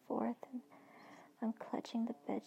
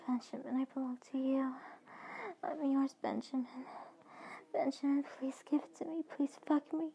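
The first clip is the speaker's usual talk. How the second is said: begging moans; 4 semitones higher than usual. Acted. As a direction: plead with him, rising in need. She answers him, begging.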